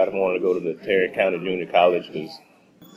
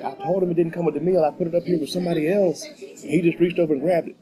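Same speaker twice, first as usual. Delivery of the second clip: higher-pitched quoted explanation; voicing the complaining coworker; 8 semitones higher than usual. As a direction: relay her complaint in a raised pitch. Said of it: "she's like".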